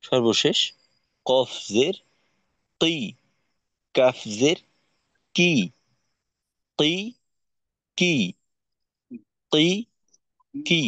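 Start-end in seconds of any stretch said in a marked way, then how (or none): 1.2-10.8 কি from deep in the throat, then কি further forward; deep and front k. Shown as a demonstration. Teacher contrasting two Arabic k letters, deep against front. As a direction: place the first কি lower in the throat. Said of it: "তার থেকেও একটু নিচে".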